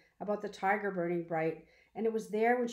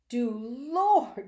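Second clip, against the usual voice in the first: loud, high cry of astonishment; old woman startled by the news of a tiger; exclamation; 5 semitones higher, 9 dB louder than usual. A narrator's reading. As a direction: burst out in shock. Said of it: "exclaimed Willie Mae".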